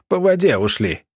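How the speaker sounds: ordinary delivery, nothing marked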